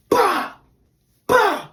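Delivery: two sharp, high, loud bangs, "bah" each; door-kick sound. Retold or imitated. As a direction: punch out each kick like a boot hitting wood. Imitating kicks on the door.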